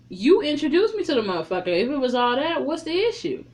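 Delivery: high, loud, fast heated retort; heated quote. Retold or imitated. Mickey snapping at jealous Lytris, heated high retort. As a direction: throw it back at her, fired up.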